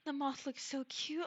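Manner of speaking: ordinary delivery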